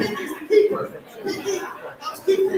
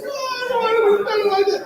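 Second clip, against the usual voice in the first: mock crying; 6 semitones higher than usual. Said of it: "He's crying".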